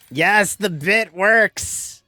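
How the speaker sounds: loud, high, drawn-out delight; gleeful victory cry; real triumph. Real, not acted. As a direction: crow slowly that the joke landed. Delighted the accent fooled a viewer.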